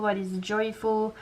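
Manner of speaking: usual speaking voice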